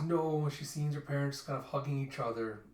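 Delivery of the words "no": mild mock scream, louder; a restrained acted cry; retold scream. Daughter in a nightmare seeing her parents, mild retold scream. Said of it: "And she screams".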